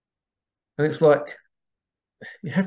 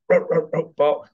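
playful barking, a little higher and brighter; sheepdog impression; 5 semitones higher, 3 dB louder than usual. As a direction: bark like a herding sheepdog, in fun. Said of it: "It's my sheepdog impression".

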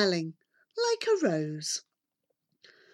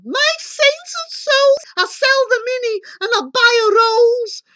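high, loud excited mother; yelling out loud; 14 semitones higher, 9 dB louder than usual. A narrator's reading. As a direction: yell orders in greedy excitement. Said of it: "She yells out loud".

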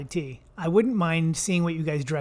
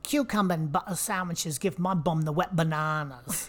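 comic British character voice; put-on British accent. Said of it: "I did look up how to say banana".

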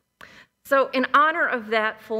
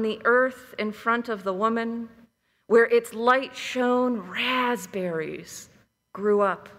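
calm, measured storytelling voice; story narration. A narrator's reading. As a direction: tell it like a fireside legend. Reads a Cherokee legend aloud.

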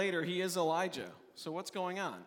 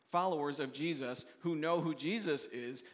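ordinary talk, nothing marked

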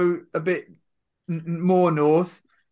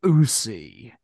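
Westwood mic whisper; 7 semitones lower, 4 dB louder than usual. Retold or imitated. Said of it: "So he'd go".

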